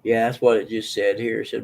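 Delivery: normal talking voice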